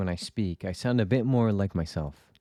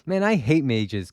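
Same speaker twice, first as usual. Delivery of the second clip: mock outburst; 6 semitones higher, 5 dB louder than usual. Mocking reaction to mage reveal.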